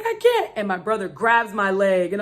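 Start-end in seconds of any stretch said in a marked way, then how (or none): 0.0-0.5 high, strained, panicky plea; panicked whine. Retold or imitated. Injured woman answering the attendants in a high, panicked plea. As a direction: cry out helplessly. Her retold panicked reply.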